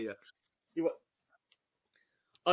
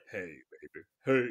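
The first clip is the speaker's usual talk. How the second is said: good and nice tone; 13 semitones lower than usual. Acted. Reader demonstrates the guide's nice tone to her, mock seductive. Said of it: "in a good and nice tone".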